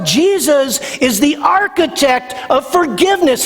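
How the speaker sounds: high, ringing preacher climax; impassioned preaching. Real, not acted. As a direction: drive the point home with fire.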